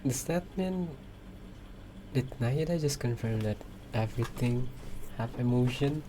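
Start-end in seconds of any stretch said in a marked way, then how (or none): none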